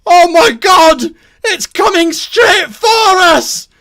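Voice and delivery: full-voiced terrified yell; panicked shout. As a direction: bellow a warning from afar, stretching the words. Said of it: "someone shouts".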